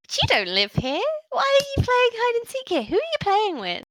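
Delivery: put-on high, suspicious little girl; a child poking holes in the story; orc child voice. Orc child doubting the players' hide-and-seek excuse, high voice.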